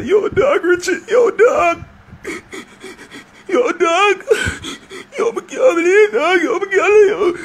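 high, sobbing imitation of a crying man; mock bawling. Man phoning his friend in heartbreak, mock bawling. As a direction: mimic a friend breaking down in tears. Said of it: "him start ball".

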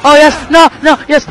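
normal talking voice

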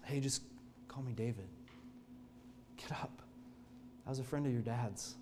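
soft, kind voice of a king to a frightened man; hushed and tender, like a friend's son; gentle reassurance. Acted. David softly calling a trembling Mephibosheth up from the floor.

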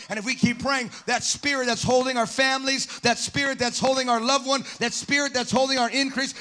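loud, rising preacher's call, hammering each line; full-force preaching. Acted. Preacher building to a shout.

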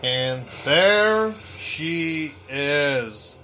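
slow, drawn-out announcement; dramatic villain introduction. Pretend. Player presenting Dark Samus the villain, slow dramatic announcing. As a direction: draw out each phrase for suspense.

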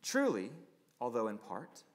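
quoted preaching. Acted. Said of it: "Listen to what he says".

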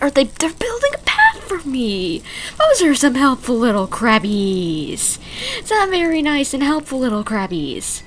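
high, loud delight; cooing with joy. Real, not acted. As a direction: gush over the crabs. Delighted by helpful crabs.

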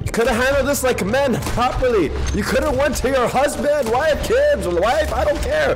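high-pitched mock-angry rant; hammed-up indignant lecture; heated scolding. Heated telling-off in game gunfight.

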